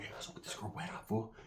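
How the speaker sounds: hushed unvoiced whisper. Asking his cousin about the girl behind her back, whisper. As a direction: whisper it so she can't hear.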